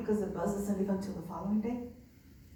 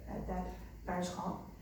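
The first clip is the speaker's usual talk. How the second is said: ordinary talk, nothing marked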